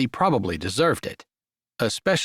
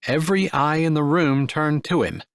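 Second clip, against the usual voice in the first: usual speaking voice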